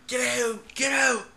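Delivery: high-pitched mock yell to get out; mock shouted order. Fed-up worker imagining walking out on an order, high mock yell. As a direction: call it out high, in short bursts. Acting out storming off the job.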